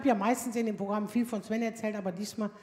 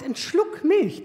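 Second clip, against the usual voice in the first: slow, higher, drawn-out mock innocence; teen son quoting; 6 semitones higher, 4 dB louder, much slower than usual. Son asking mum for just a sip, slow mock innocence. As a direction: draw it out, playing innocent. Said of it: "der sagt".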